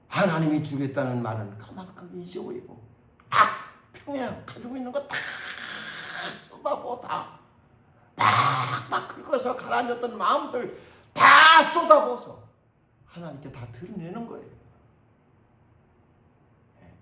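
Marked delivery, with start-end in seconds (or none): 3.3-12.4 high, loud fiery preaching; fervent sermon climax, slowing on the peak; preaching at full force. Acted. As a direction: pour it out from the pulpit, building hard.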